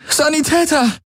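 high desperate call for a medic. Acted. Kirai calling for a medic amid the dead, high desperate call. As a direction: cry out across the battlefield. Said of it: "rief sie".

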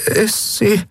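put-on high, faint halting boy's voice; stunned question. A narrator's reading. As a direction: barely dare to ask. Stunned boy asks haltingly. (stockend, hoch und fassungslos)

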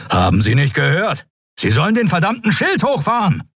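exasperated, high-pitched order; irate commander calling out; angry command. A narrator's reading. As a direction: demand obedience, pitch climbing steeply. Ignored admiral angrily repeats order.

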